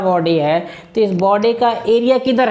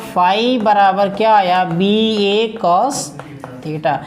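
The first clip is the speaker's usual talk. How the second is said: slow dictation; much slower than usual. Shown as a demonstration. Dictating formula to students.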